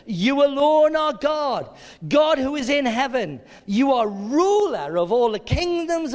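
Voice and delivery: loud, high declamation to God; ringing cry of praise, slowing toward the end; shouted praise. Acted. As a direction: proclaim it like a crowd's battle shout. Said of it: "I think they were shouting this".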